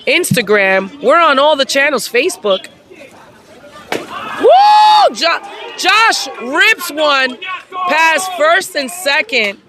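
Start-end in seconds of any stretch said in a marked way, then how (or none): 4.4-5.2 loud, very high whoop; excited cheer. Real, not acted. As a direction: scream it like a home-run fan. Cheering a hit.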